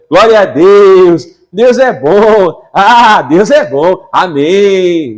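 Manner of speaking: slow, loud exultant praise; joyful praise. Acted. Preacher acting out a believer returning with joy, slow and loud. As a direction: savour each word of joy.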